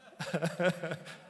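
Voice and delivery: real amused chuckle. Real, not acted. Speaker quizzing a listener on a verse, amused chuckle. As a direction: break into a short, bouncy laugh.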